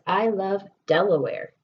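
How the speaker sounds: ordinary talk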